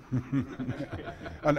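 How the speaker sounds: ordinary talk, nothing marked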